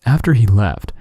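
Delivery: ordinary delivery, nothing marked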